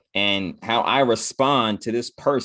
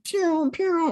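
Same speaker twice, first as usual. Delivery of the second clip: high zapping pew pew noise; pew pew sound effect. Retold or imitated. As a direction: mimic laser zaps for a fast market. Imitating market flying.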